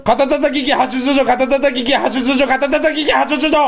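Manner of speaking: rapid tongue twister. Shown as a demonstration. Challenger tries again for his partner, rapid tongue twister. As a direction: push through three rounds fast. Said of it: "おー、行くぞ。".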